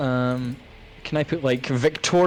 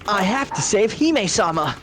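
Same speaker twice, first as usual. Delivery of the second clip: put-on anime boy voice; 7 semitones higher than usual. Anime protagonist voice again.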